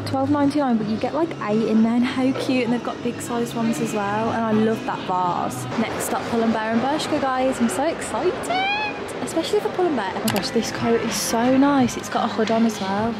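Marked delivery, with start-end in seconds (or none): none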